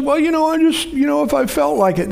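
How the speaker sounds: mock whiny woman. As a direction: mimic her whining about feelings. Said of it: "And she said".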